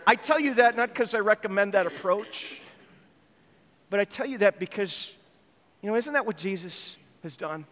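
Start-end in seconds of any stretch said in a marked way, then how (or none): none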